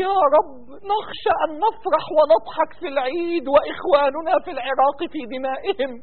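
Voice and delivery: hushed, sorrowful prayer; grieving plea. Real, not acted. Worshipper fearing joy while Iraq bleeds, grieving plea. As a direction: let grief weigh on the supplication.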